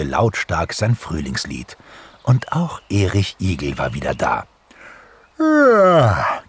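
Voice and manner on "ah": big high yawn sliding down; loud yawn. A narrator's reading. Hedgehog waking from winter sleep, yawning loudly. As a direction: yawn loudly, just woken up. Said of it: "gähnte er laut".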